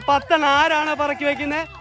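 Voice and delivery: usual speaking voice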